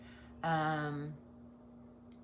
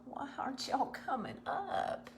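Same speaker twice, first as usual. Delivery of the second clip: higher, unvoiced whisper; 6 semitones higher, 5 dB quieter than usual. Real, not acted. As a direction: whisper the question up to the chat. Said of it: "I don't know why I'm whispering".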